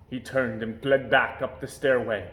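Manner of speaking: ordinary delivery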